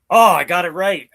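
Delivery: loud, high burst of delight; excited. Real, not acted. Streamer finding out his guess was right, excited. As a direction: exclaim it, pleased with yourself.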